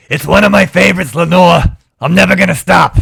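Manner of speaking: high, loud Long Island grandma voice; Long Island granny accent. Pretend. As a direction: play a brassy Long Island granny teasing a friend. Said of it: "my Long Island granny accent".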